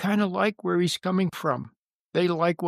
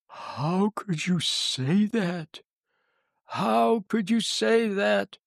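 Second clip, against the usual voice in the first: slow, drawn-out scolding; reproachful; 4 semitones higher, much slower than usual. Retold or imitated. Meeting organizer scolds the guest speaker, reproachful. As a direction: imitate an accusing rebuke, stretching each word. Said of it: "Like I had done something wrong".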